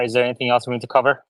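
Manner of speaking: ordinary talk, nothing marked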